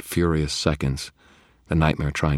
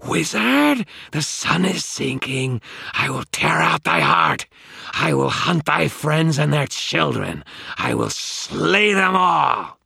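slow, high, loud monster threat; howling in triumph; 13 semitones higher, 6 dB louder, slower than usual. A narrator's reading. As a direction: howl vengeance as a freed demon. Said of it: "it howled in triumph".

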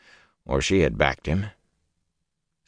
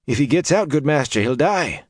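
higher, worried peasant voice; anxious villager; 9 semitones higher than usual. Acted. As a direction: plead it nervously, pitched up. Simeon voiced.

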